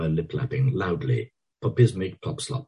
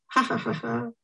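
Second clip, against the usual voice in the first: high giggling laugh; girls giggling; 3 dB quieter than usual. A narrator's reading. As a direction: giggle like a girl. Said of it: "Zoe and Florrie laugh together".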